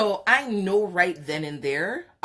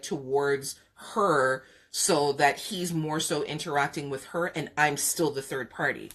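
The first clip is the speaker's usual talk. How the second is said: ordinary delivery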